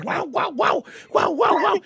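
ladridos agudos de perro, cada vez más fuertes; ladrido imitado. Retold or imitated. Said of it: "el perro empieza a ladrar". (ladridos agudos imitados)

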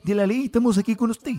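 fast, put-on Chilean accent; mock Chilean radio voice. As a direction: rattle it off like a Chilean TV host. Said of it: "el acento chileno".

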